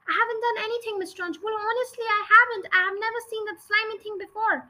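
put-on high, fast protesting girl voice; frightened child denying everything; pleading schoolgirl. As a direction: beg to be believed, breathless.

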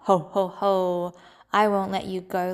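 loud booming ho ho ho; gloating lion laugh. Acted. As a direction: laugh over the caught prey. Lion gloats over mouse. (singa menertawakan tikus dengan keras)

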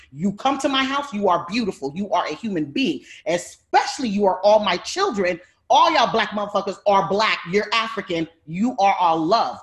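loud, heated scolding of the stepson; retold angry lecture. Scolding stepson about colorism.

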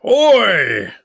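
huge, high bellow; roar. A narrator's reading. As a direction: roar it like a volcano. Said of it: "roared the voice".

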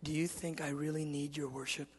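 very soft questioning; God's quiet challenge. Retold or imitated. God questioning his son about worship, very softly. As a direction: question gently, well under normal volume. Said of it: "He goes".

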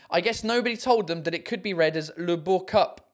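normal talking voice

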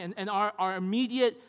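ordinary talk, nothing marked

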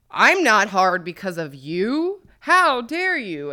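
loud, high, offended yell; indignant shout. Retold or imitated. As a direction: act outraged and loud. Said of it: "the guy has to be like".

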